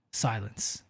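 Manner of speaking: ordinary delivery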